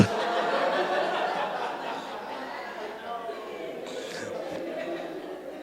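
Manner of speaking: long airy laugh; real laugh after the punchline. Real, not acted. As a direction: break into breathless laughter. Laughs at his own joke.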